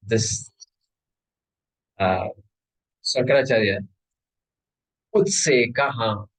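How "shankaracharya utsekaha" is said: ordinary delivery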